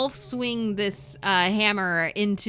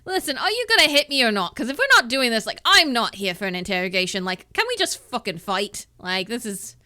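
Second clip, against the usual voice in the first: louder, faster, pushing for the fight; impatient brute; 3 semitones higher, 6 dB louder, faster than usual. Acted. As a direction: demand they get on with it.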